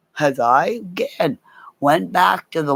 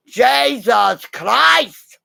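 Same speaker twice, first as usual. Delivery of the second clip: higher, louder, long drawn-out yell; shocked shout; 8 semitones higher, 4 dB louder, much slower than usual. Retold or imitated. Peter coming out of the fridge, a long shocked yell. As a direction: bellow it slowly, like a chef in shock. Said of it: "all you heard was".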